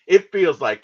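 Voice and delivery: ordinary talk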